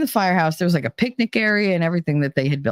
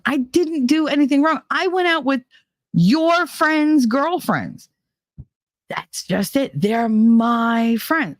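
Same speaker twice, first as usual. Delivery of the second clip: high, drawn-out protest; upset pleading; 7 semitones higher, slower than usual. Retold or imitated. Defending herself to the jealous boyfriend, high pleading protest. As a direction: argue like you're wronged.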